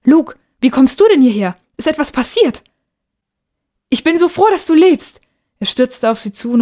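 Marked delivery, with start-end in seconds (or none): 0.0-2.6 high, louder startled questions; startled alarm. Acted. Mother startled to find her son here, alarmed surprise. 3.9-5.2 much higher, louder, joyful burst; overjoyed relief. Acted. Boy rushes to his living mother, overjoyed.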